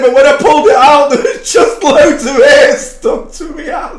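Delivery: laughing quote. Retold or imitated. Quoting brother while laughing.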